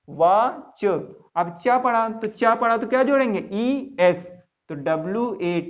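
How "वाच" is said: "वाच" with long vowel, then a separate ch; drawn-out ch pronunciation. Shown as a demonstration. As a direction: sound out "वाच", ending on ch. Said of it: "वाक तो पढ़ते नहीं". ("वाच" खींचकर, अंत में अलग से च)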